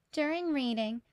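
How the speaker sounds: usual speaking voice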